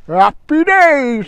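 loud, high, drawn-out cheer; shouted catchphrase. Pretend. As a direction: holler it slowly like a rallying cry.

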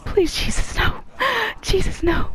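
high, scared begging; frightened pleading. Real, not acted. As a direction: beg in real fear. Monster close behind.

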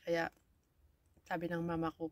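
ordinary talk

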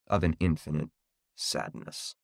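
low, fading to near whisper; hushed, bleak. A narrator's reading. Narrator closes on Jenny's sadness, hushed and fading. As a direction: let the last word die away softly. Bleak final line of the story.

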